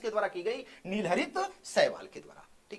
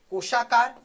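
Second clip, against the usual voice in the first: "कोशाकार" loud and in one piece; announcing the term; 6 dB louder than usual. Shown as a demonstration. Teacher announces the next type to students, "कोशाकार" said loudly. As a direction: call out "कोशाकार" as a new heading.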